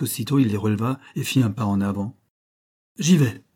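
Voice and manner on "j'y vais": higher, louder cry of resolve; exclaiming. A narrator's reading. Tréfléon volunteering to the sailors, crying out. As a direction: fling it out as a sudden decision. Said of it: "s'écria-t-il".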